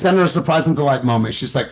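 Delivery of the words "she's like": usual speaking voice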